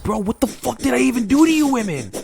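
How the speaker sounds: high, loud squeal of frustration; exasperated outburst. Retold or imitated. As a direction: yell it in disbelief at the girls. Venting at her friends, retold.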